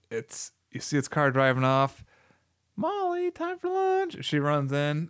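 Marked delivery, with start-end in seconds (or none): 2.8-4.2 much higher sing-song mom call; high mock mom calling upstairs. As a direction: call the kid in like a mother. Mother calling in retold film.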